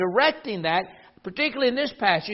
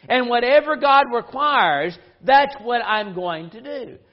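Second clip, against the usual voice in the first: slow and louder, each word weighted; emphatic declaration; 4 dB louder, slower than usual. Real, not acted. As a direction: lean into the promise. Preacher declares resolve emphatically.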